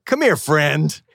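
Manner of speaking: high-pitched, playful welcoming call; hammed-up delighted greeting; eager welcome. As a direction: act out greeting a long-lost friend. Acted eager greeting to fellow Bostonian.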